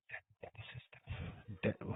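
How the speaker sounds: slow, soft, breathy repeat; puzzled whisper. Real, not acted. Streamer echoing a confusing chat line in a slow whisper. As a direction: whisper the name back, confused.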